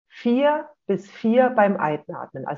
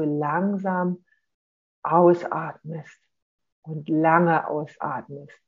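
langsam, gedehnt, wie ein langer Atemzug; langsam vorgesprochen; 3 semitones lower, much slower than usual. Shown as a demonstration. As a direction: ziehe „langsam“ und „lange“ ruhig in die Länge. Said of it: "mit Betonung auf dem Ausatmen". (ruhig und gedehnt, wie beim Ausatmen)

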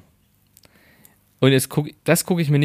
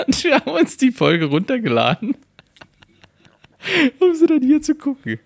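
much higher, helpless laughing delivery; 12 semitones higher than usual. Real, not acted. Confessing the trip to download an episode, laughing. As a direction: barely get it out through laughter.

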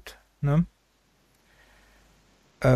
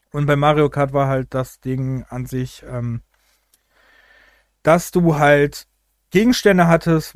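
ordinary delivery

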